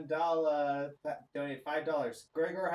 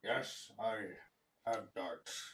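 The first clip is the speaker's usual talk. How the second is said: low, soft gruff cyclops; 7 semitones lower, 8 dB quieter, slower than usual. Acted. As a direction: rumble it out like a slow giant. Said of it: "he looks over and says".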